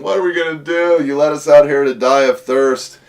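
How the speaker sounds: whining complaint. Acted. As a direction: whine like a stranded crowd. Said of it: "grumbling and complaining and whining".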